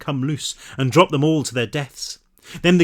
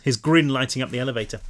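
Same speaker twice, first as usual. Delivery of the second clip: usual speaking voice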